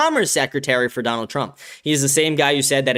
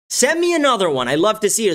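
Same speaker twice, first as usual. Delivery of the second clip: repeated much higher, hammed-up gloating; mocking quote; 7 semitones higher than usual. Mocking the congressman's gloating tweet to his audience, high and sneering. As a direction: parrot him back high and mocking to ridicule him. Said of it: "is what he said about a picture".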